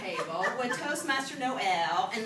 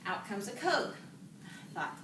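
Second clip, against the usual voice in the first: normal talking voice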